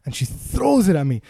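normal talking voice

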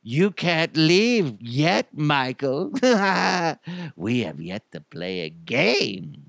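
slow, drawn-out menacing clown voice; sinister clown, swooping and drawling; creepy clown. Acted. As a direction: toy with a trapped victim, slowly. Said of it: "sounded like a creepy clown".

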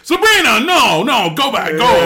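loud, high-pitched shooing shout; retold yelled order to retreat; imitated calling off. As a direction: shout her away, mock urgent. Calls off the summoned girl.

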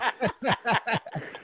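short, high laugh; real high giggle; amused laugh. Real, not acted. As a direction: chuckle high as a friend signs off. Amused as the caller signs off.

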